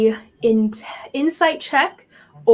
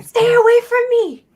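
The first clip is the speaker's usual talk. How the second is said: put-on high, loud, strained child voice; yell-whispering kid; 13 semitones higher, 8 dB louder than usual. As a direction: warn the stranger off as a frightened kid. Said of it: "yell whispering at you down the alley".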